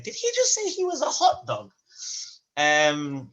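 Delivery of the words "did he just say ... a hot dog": high, incredulous mock question; confused audience member. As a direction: sound baffled as a listener in the crowd. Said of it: "some of them in the audience going".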